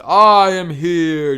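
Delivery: big, drawn-out heroic announcement; booming, stretched-out arrival call; All Might's entrance. Acted. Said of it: "All Might would bust in".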